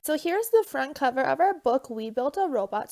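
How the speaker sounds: ordinary talk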